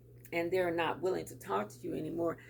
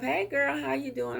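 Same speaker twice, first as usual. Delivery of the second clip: bright, breezy hello as if nothing happened; cheery greeting; 8 semitones higher, 4 dB louder than usual. Pretend. Calling an estranged friend, breezy and cheerful. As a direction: greet her like no time passed. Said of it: "call 'em up".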